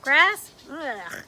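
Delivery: usual speaking voice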